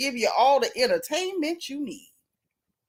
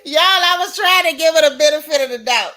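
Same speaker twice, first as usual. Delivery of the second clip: loud exasperation; 4 semitones higher, 7 dB louder than usual. Real, not acted. Exasperated outburst to viewers.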